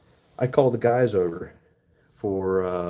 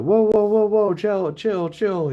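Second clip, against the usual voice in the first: high, loud whoa trailing down to chill; retold alarmed shout; 12 semitones higher, 5 dB louder, slower than usual. Friends yelling at the girl chugging vodka, fading to a calmer chill. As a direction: yell to stop her, then ease off.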